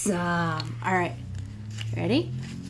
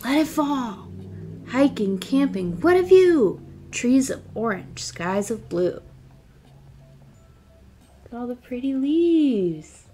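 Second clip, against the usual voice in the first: lively picture book read-aloud; reading to children; 4 semitones higher than usual. Acted. Teacher reading a picture book aloud to her class. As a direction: read it like story time for little kids.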